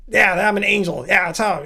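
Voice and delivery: a hint of a deep, gravelly angel; Castile. Imitating the Supernatural angel, mock deep gravelly voice. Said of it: "He speaks in this deep, gravelly voice".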